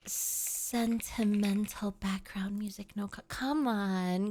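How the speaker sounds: whispered; hushed mock-sentimental whisper. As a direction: breathe the words without voice. Setting mood whisper.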